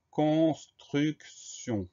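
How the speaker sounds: mot dicté très lentement, plus aigu; détacher le long mot pour la dictée; articulation très lente. Shown as a demonstration. (diction de dictée, syllabes étirées)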